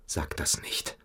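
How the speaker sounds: urgent hushed whisper; a frightened whisper asking him not to say it. Acted. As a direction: breathe it out, barely voiced. Hushed plea in tense interrogation.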